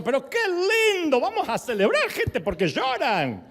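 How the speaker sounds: high, loud, hammed-up celebrating; rally the mourners with stretched-out mock joy; imitating a jubilant pastor. Pastor urging mourners to celebrate, exuberant. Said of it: "Juan Carlos siempre hacía eso".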